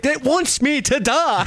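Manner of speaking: high, slow mock wail at the wheel; whiny mock outrage. Retelling how Johnny reacted to the wheel, high slow mock wail. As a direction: ham up a wrestler's high, drawn-out despair. Wrestler retold quitting in disgust.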